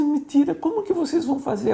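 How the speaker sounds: trapped woman begging the pastor on the phone; crying plea. Retold or imitated. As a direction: plead through tears to be rescued. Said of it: "ela falava comigo chorando".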